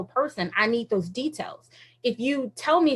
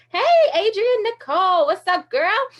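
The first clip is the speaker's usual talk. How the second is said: higher, louder friendly hello; Jesus greeting her playfully, higher and louder; cheery greeting; 8 semitones higher, 4 dB louder than usual. Retold or imitated. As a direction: call her name brightly like an old friend. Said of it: "he was like".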